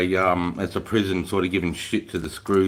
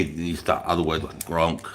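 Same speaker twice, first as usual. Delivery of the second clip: ordinary delivery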